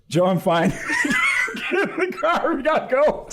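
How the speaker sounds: high, laughing retelling of brushing off tears; laughing through it. Retold or imitated. Priest retelling telling buddy he's fine, laughing. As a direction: laugh while insisting you're fine.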